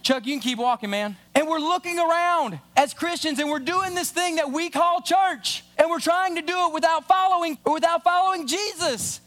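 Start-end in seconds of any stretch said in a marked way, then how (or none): 1.3-9.2 higher, rising preacher's build; preaching. Real, not acted. Pastor presses the congregation, higher and building. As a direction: drive the point home from the pulpit.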